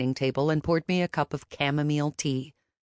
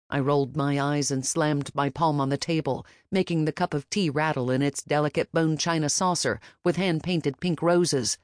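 ordinary delivery, nothing marked

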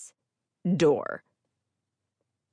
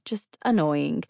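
plain reading voice

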